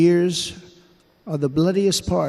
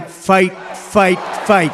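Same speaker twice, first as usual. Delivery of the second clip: loud, higher punched chant; retold rallying shout; 3 semitones higher, 4 dB louder, slower than usual. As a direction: call it out like a rallying cry. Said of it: "and started shouting".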